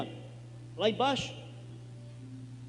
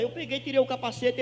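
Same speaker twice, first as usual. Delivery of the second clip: usual speaking voice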